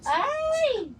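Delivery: sudden high startled cry; high shocked "ay"; surprised yelp. Real, not acted. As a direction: yelp in surprise as something tips. Startled by something falling.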